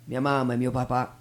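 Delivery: ordinary talk, nothing marked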